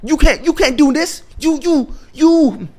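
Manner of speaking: high-pitched protesting townsperson voice; high, sputtering mock complaint; indignant townsfolk. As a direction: squeal the scolding in a high voice. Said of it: "South Park calls him and be like".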